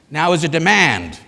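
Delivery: loud, forceful command; demanding. Shown as a demonstration. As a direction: say it like a firm demand. Models the demand asked of audience.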